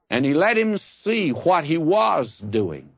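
ordinary talk, nothing marked

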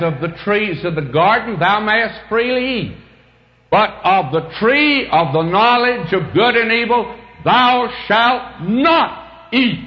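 booming commandment voice slowing on the final not; stress on not. Acted. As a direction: proclaim the command and lean hard on not. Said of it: "God put a "not".

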